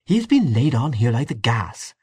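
ordinary delivery

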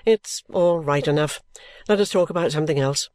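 low character voice for an offhand man; careless Richard. Acted. Richard brushing off talk of his career, carelessly. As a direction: play him low and unconcerned.

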